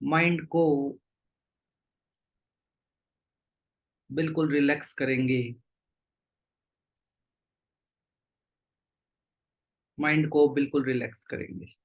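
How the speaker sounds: low, unhurried meditation-guide voice with long pauses; guided meditation. Real, not acted. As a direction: settle the group gently, leaving long silences. Leads group into meditation.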